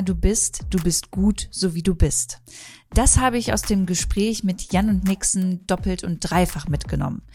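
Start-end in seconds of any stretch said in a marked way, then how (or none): none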